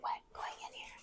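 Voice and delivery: slow hushed whisper. Real, not acted. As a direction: whisper it slowly. Whispering in a cave.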